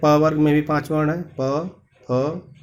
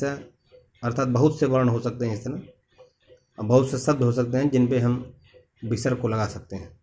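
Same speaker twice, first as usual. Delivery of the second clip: normal talking voice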